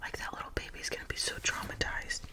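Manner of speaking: ordinary talk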